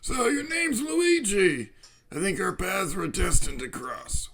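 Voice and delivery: lightly voiced old man professor; a hint of a doddering old scientist.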